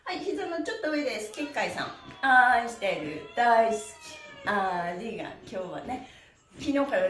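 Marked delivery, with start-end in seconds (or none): none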